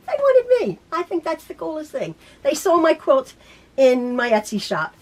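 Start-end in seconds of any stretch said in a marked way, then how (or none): 0.1-0.8 high, loud, delighted exclamation; thrilled squeal. Real, not acted. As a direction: squeal it with real delight at being picked. Repeating her thrill at being chosen.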